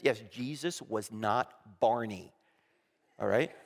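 usual speaking voice

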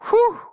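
high, loud mock whew of relief; relieved whew. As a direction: blow out a big pretend sigh of relief. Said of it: "half of his audience going".

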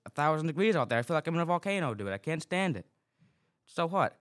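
overheated, worked-up complaint. Real, not acted. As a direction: rant like the heat is frying your brain. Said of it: "I'm so worked up".

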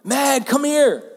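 called out high and loud across the house; self-mocking yell for his wife; imitating his own shouts for Meg; shouting a name. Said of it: "I'm always shouting my wife's name".

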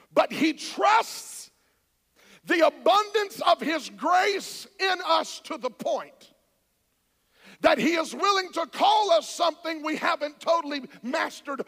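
slow building preaching. Acted. Pastor pressing grace on his listeners, preaching slowly. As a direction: stretch the words out, then push loud.

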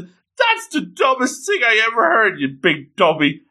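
high-pitched, indignant comeback; indignant retort. Acted. Hori answers Miyamura's embarrassing compliment, indignant.

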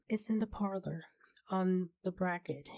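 ordinary delivery, nothing marked

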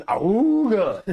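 slow, loud, high awooga like a klaxon; ahooga horn. Pretend. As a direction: honk it like an old car horn. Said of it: "get an awooga horn".

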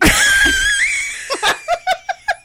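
high, loud shrieking laugh. Real, not acted. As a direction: burst into a piercing laugh. Cracks up at joke.

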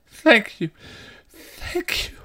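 high, strained thanks sinking to a whisper; crazed gratitude, fading to unvoiced breath; insane knight. A narrator's reading. Insane knight squeezing his eyes shut, crazed.

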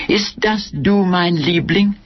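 high, cooing lover's voice in German; silly-sweet high voice; fatuously sweet. A narrator's reading. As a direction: coo sweetly to a darling on the phone. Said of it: "Her voice opened in a fatuously sweet smile".

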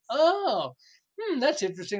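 high oh easing into a musing hmm; high mock intrigue; intrigued. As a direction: sound pleasantly caught off guard. Voicing intrigued listeners.

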